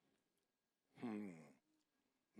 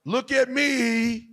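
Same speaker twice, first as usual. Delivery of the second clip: mock attention seeker; 6 semitones higher, 11 dB louder, much slower than usual.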